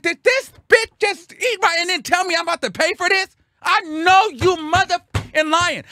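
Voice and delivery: very high-pitched, incredulous outburst; outraged rant. Retold or imitated. Ranting to mama about being charged, outraged.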